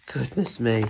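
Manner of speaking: usual speaking voice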